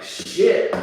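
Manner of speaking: sharp, high burst of alarm; shocked curse. Retold or imitated. Friend reacting to a gun at the window, shocked. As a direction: blurt it out loud.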